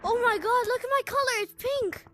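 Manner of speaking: squealing with delight; thrilled, high and loud; excited. Real, not acted. Delighted at colour change.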